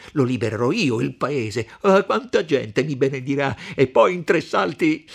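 loud, rising fervour of revenge; fierce, triumphant shouting; exalted rant. Acted.

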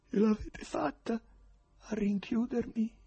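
lento e acuto, amaro; rabbia trattenuta. A narrator's reading. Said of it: "sorrise con rabbia".

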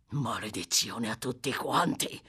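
low growled curse, held in; cursing. A narrator's reading. Sykes rails at his three silent companions, angry and low. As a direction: curse them all under a dark low voice. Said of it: "inveì Sykes".

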